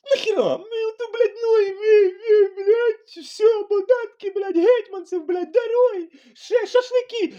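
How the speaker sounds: hammed-up sobbing shout of a whiner listing grievances; mocking whiny wail. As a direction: squeal it like a spoiled complainer throwing a tantrum. Mocks whining complainers.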